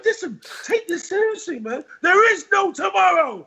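Rocky trainer. Retold or imitated. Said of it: "He goes".

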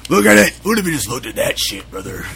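lightly voiced gruff rocker; [name] voice. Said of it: "That's my [name] voice".

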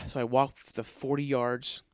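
ordinary talk

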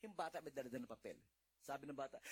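ordinary talk

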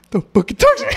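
mock the ex's angry reply while cracking up; laughing mock anger. Ex's angry morning reply retold, high and laughing.

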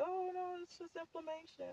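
high, soft brushing-off doctor; dismissive doctor. Retold or imitated. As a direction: mimic a dismissive nurse. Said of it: "they say".

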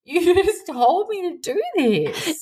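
high, laughing-edged mock protest; amused disbelief. Mother amused at her toddler's tantrum, high pitched. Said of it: "I just start kinda a bit laughing".

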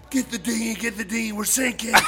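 high-pitched urgent call to crew; urgent alarm. Retold or imitated. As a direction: imitate a friend sounding the alarm. Friend shouting as boat sinks.

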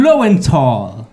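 Lowenthal slow in a German accent. Shown as a demonstration. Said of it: "Tengo que leer así".

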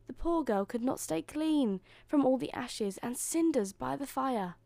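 plain reading voice

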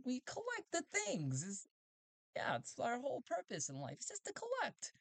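very high, excited robot voice; strange collector robot. Acted. Robot explaining its collecting to Talty, very high voice. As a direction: gush about collecting, squeaky high. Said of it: "is like".

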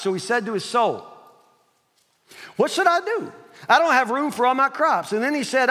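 high, forceful sermon delivery; preaching. Acted. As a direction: preach the parable out high and strong. Preaches the parable at full force.

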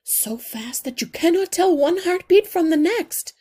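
high, loud, hurried and close to panic; frantic mother mouse. A narrator's reading. Mother mouse telling the healer her son is delirious, high and panicked. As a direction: pour out the fear for your sick child. Mother describes son's pulse.

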